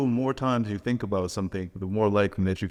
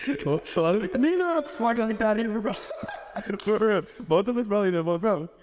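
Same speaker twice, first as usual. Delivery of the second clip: laughing; 12 semitones higher than usual. Real, not acted. Laughing at themselves.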